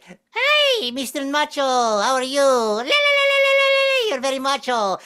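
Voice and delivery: mocking nacho greeting. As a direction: whoop it out as a trilling tease. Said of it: "They're like".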